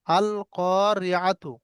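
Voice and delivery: very slow, each syllable drawn out; slow recitation. Shown as a demonstration. Teacher reciting the Quran word slowly for students. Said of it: "এখানেও দেখেন".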